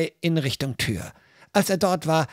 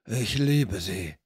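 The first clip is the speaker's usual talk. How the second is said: low, slow rough thug; 7 semitones lower, 3 dB quieter, slower than usual. Acted. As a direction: drag it out low and sulky. Thugs quarrel behind the door. (Ganove hinter der Tür: tief und langsam)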